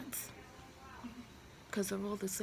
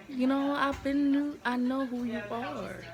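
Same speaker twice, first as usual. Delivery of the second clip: angry retort; 5 semitones higher, 6 dB louder than usual. Retold or imitated. Confronting cheating boyfriend.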